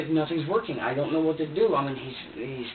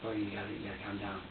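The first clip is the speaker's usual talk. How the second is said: soft soothing; 4 semitones lower, 9 dB quieter, faster than usual. Retold or imitated. Soothing screaming baby.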